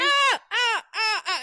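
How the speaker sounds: high, loud repeated yelps; short bursts of hammed-up alarm; mock panicked screams. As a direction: yelp in comic horror. Acted panic at roofie reveal.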